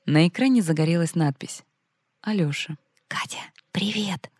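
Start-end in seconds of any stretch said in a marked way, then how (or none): 3.1-4.3 slow, hushed phone whisper. Acted. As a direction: whisper so nobody at home hears. Alyosha secretly calls on phone. (шёпотом в трубку)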